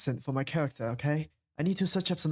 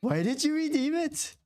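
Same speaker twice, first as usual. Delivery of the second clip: much higher, slower, pleading disbelief; exasperated; 12 semitones higher, slower than usual. Real, not acted. Asking a friend again why they redeemed the card, higher and slower in disbelief. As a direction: drag it out in high exasperation. Asks again, more upset.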